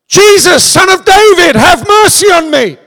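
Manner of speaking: high, loud cry for mercy; desperate shout. Acted. Blind beggar shouting to Jesus, loud desperate plea. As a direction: call out across the crowd, begging. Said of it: "Bartimaeus shouted".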